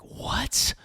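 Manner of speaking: high, airy mock-shocked question; retold shock. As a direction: blurt it in mild disbelief. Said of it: "we were both shocked".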